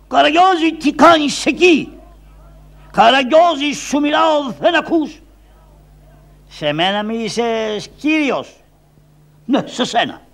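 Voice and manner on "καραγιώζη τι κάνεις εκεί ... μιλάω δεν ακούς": high, loud call up into a tree. Acted. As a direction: shout his name to get his attention. Calling up into the tree.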